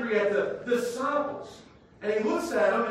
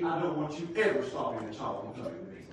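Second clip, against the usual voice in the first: normal talking voice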